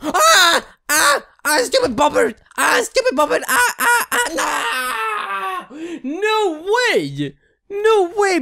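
high shrieking panic; high panicked yelling; panicked screams. Real, not acted. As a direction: scream as the game attacks you. Game character attacks him.

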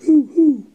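high loud hooting owl; owl hoots. Acted. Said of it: "let's review".

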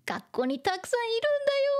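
high, fast indignant protest; defensive older sister. Acted. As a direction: insist quickly in a high voice. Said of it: "using her scary voice".

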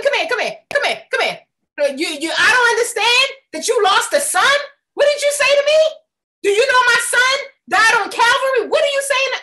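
loud, high outraged retort; indignant God. Retold or imitated. God retorting about his son, loud and high. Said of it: "God was like".

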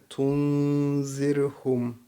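Tunzirhum with the n held in the nose, slow; slow Tunzirhum, nasal n; nasal nun. Shown as a demonstration. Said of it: "Burada nun harfi cezimli".

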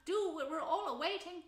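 high, bossy little field mouse; small mouse organising the choir, high-pitched; field mouse leader. Acted. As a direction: chivvy the young carollers along in a high voice.

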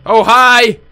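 jumpy startled greeting. Real, not acted. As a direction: caught off guard, jump a little.

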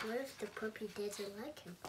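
quick aside. Acted. Reader questioning the pigeon, soft and quick. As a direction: toss it off quickly under the breath. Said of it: "reader reads the reader's reply".

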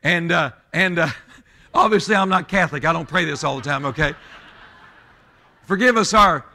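high, loud pulpit delivery; booming sermon cadence, driving each phrase home; full-force preaching. Real, not acted. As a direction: preach it out hard, voice pitched high over the congregation.

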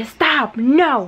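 high, firm mock reprimand; retold scolding. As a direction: order the dogs to quit, retelling it. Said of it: "los empecé a regañar". (regaño firme y agudo, imitado)

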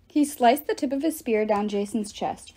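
ordinary delivery, nothing marked

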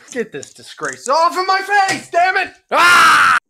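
high, loud frantic shout; loud yell. Acted. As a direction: yell as if something is stuck on your face. Yelling while pulling something off.

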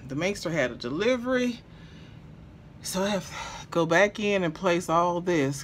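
normal talking voice

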